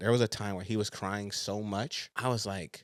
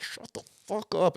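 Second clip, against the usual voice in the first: higher, tense mock snap; retold snap; 9 semitones higher than usual. Mother retelling snapping at her crying baby, tense and high. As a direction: throw it at the crib in exasperation.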